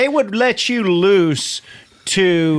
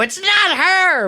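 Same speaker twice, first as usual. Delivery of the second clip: crowd voice; 12 semitones higher, slower than usual. Retold or imitated. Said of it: "rip the mask off of you and go".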